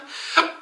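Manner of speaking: sharp high hiccup gasp; a sudden hiccup catches the breath. Real, not acted. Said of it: "I have the hiccups".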